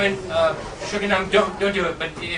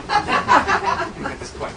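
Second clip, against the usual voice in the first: laughing; 14 semitones higher, 5 dB louder, much slower than usual. Real, not acted. Laughing at own joke.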